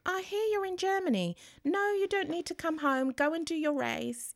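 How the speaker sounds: high, bright retelling of the doctor; quoted paediatrician. Retold or imitated. Paediatrician reassuring the worried mum, high and brisk. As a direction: lift the voice high like a cheery doctor. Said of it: "she was like".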